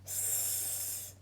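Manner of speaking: long hissed s, teeth close together; drawn-out s like a snake. Shown as a demonstration. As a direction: hiss the S like a snake. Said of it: "you do it".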